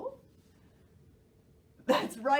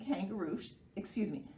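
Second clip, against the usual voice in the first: usual speaking voice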